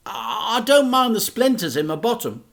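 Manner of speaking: put-on a cheeky young lad; boy voice. A narrator's reading. Boy tells dad, in a quick kid voice.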